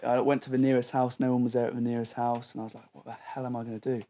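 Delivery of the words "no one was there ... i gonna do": ordinary talk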